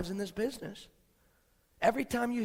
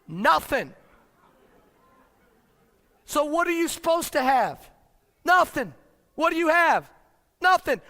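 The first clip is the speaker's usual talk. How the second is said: each nothing louder and higher; building emphasis; 6 semitones higher, 7 dB louder than usual. Real, not acted. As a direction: hammer the word nothing harder each time. Repeated answer building louder.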